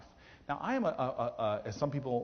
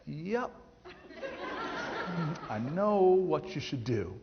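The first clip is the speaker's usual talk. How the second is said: knowing teacher through laughter; 5 semitones higher, slower than usual. Retold or imitated. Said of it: "And I'm like".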